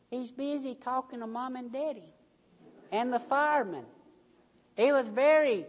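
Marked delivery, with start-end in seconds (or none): 0.1-3.8 put-on child's whisper. A narrator's reading.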